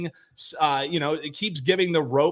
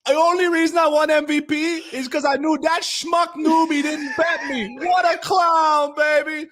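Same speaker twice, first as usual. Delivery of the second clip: mock rant; 11 semitones higher, 4 dB louder than usual. Mock trash talk rant.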